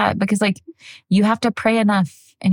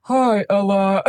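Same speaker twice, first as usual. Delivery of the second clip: put-on head down, changed ghostly kid voice; child playing the ghost; slower than usual. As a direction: pretend ghost voice, done by a toddler. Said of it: "she pretends to be the ghost".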